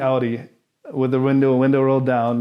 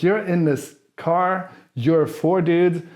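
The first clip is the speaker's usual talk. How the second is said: ordinary talk, nothing marked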